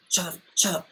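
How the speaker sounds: urgent whisper, acted out; whispered plea. Begging the yelling crowd to stop, whispered. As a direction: hiss it under your breath in church. Muttered plea during church.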